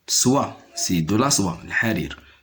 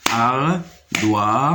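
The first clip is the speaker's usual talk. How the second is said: "Ardoise" split into slow syllables, ar-doi-se; découpage syllabique. Shown as a demonstration. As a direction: break "Ardoise" into three separate beats with pauses. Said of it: "se compose de trois syllabes". (« Ardoise » découpé lentement : ar… doi… se)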